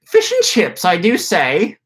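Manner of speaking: very high, hammed-up posh British accent; a cartoonish English toff, British accent; British accent impression. Joking on after his impression, hammed-up British accent. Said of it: "my impression of a British guy".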